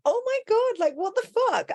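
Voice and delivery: high-pitched retold disbelief; astonished reaction. Coach retelling hearing her spark spat back, high astonishment. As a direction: re-enact stunned amazement, voice shot way up. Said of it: "I was just like".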